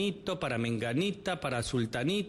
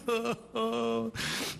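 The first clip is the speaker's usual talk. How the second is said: high giggle; laugh; 7 semitones higher than usual. Real, not acted. Host laughing after mock tears. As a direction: giggle at the act.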